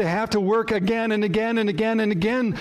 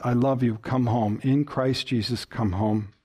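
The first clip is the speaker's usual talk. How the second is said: tender invitation; 7 semitones lower than usual. Shown as a demonstration. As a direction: speak it gently like a father calling his child home. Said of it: "Those are sweet words".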